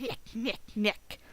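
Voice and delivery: mimicking the game character's little noise; short, repeated mock creature noises; imitated creature sound. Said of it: "look at that sound he's making".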